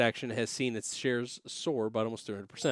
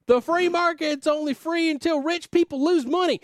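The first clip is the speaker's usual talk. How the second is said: much higher, louder Southern yokel voice; Southern accent; 6 dB louder than usual. Retold or imitated. Host reads a tweet in an exaggerated Southern accent. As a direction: squawk it out like a riled-up Southern trader. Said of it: "making these guys extremely Southern".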